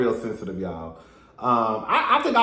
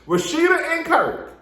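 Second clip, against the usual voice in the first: names sung out high, falling at the end; sing-song announcement; 13 semitones higher than usual. Acted. To viewers, high announcing her next couple. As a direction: call out the couple like a host.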